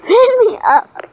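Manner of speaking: whiny begging. Real, not acted. Kid begging to be lifted.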